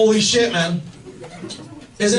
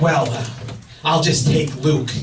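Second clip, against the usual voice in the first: annoyed tech; 9 semitones lower than usual. Retold or imitated. Vet tech offering to take the dog, mildly annoyed. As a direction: imitate restrained annoyance, pitched low. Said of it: "she's fucking annoyed at me".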